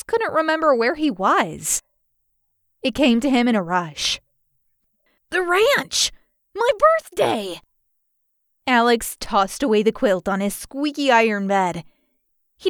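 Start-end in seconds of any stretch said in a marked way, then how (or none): 5.3-7.6 high-pitched thrilled exclamation; gleeful, high little cry; excited realisation. A narrator's reading. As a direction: burst out with sudden delight. Sudden happy realisation of birthday.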